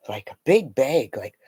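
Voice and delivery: usual speaking voice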